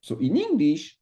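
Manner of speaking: ordinary talk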